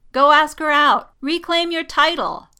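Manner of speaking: high, loud, emphatic urging; rousing challenge. A narrator's reading. Poe dares Jess to ask Rey out, rousing. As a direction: goad your friend into action.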